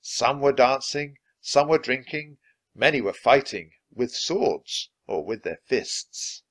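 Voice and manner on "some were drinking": ordinary delivery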